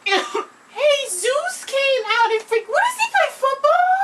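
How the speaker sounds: high, squealing laugh-talk; laughing disbelief. Real, not acted. Friend reacting to Jesus tackling, squealing with laughter.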